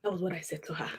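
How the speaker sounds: soft unvoiced whisper. Shown as a demonstration. Whispering her own words back to the lady. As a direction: breathe the words out without voice.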